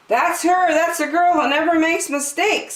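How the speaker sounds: audience woman. A narrator's reading. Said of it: "said a woman in the audience".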